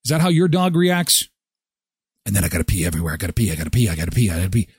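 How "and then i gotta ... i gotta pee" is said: dog voice. Acted. Dog voice, needs to pee.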